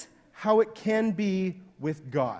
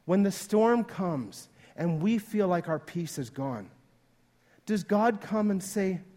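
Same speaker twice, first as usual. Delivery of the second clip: ordinary talk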